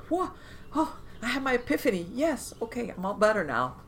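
high, breathless mock revelation with a cry in it; mock epiphany. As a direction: play the instant healing for a laugh.

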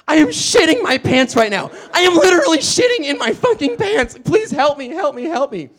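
overacted panic, begging for help; fake meltdown. Fake crisis, clutching shorts.

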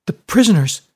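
sudden high loud exclamation; alarmed cry. A narrator's reading. Fiona to Brody, remembering the prisoners with alarm. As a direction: blurt it out in alarm.

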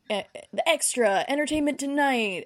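news broadcaster voice. Acted. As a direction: list it like showbiz headlines. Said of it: "news broadcaster voice".

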